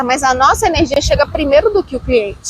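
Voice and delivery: ordinary talk, nothing marked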